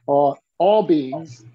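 normal talking voice

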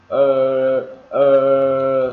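very loud, sustained "er" for "ờ"; steady, drawn-out R drone on "ờ"; R-coloured vowel. Shown as a demonstration. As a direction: hold "ờ" long with the tongue curled. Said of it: "Nghe nó hơi ờ một tí".

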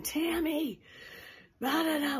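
high-pitched mock scream of her name; imitating a yell. Mimicking her angry ex-husband yelling her name from the living room, high mock scream. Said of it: "he was screaming".

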